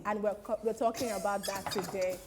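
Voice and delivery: news anchor. Pretend. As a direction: bring the mock bulletin down to a sober close. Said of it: "welcome to the nine o'clock news".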